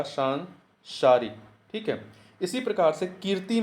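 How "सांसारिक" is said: सांसारिक said very slowly; slow demo. Shown as a demonstration. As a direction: drag out सांसारिक syllable by syllable. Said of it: "सा पे आ की मात्रा". (सांसारिक धीरे धीरे)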